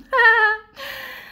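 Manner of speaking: loud, very high silly cackle; put-on high laugh. Chronicler joking about June weather, high silly laugh. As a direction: burst into a high, exaggerated laugh.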